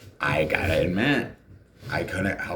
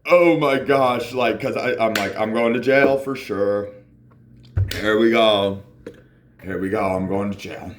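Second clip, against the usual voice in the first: mock panic; 4 semitones higher, 5 dB louder than usual. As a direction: freak out, sure of jail. Panicking at gas station.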